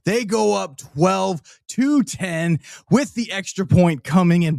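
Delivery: high, drawn-out touchdown call, building to shouts; live play-by-play. Acted. Fan at home calling the Rams touchdown live, excited play-by-play. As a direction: call the score like a broadcaster as it happens.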